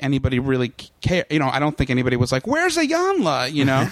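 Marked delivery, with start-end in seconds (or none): 2.5-3.5 high, slow mock puzzled question; a drawn-out, high-pitched "Where's Iyanla?"; mock bewildered fan. Nobody missed Iyanla, mock quote.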